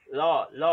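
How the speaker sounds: ल said twice, loud and deliberate; sounding out a letter. Shown as a demonstration. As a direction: repeat ल clearly so children hear it. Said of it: "तीसरा अक्षर हमारे होठों से निकला".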